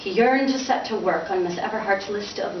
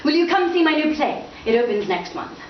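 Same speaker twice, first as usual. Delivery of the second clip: bright, higher congratulations; sudden brightness; 8 semitones higher than usual. Acted. Father suddenly bright toward his son. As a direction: switch to sunny cheer. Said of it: "with sudden brightness".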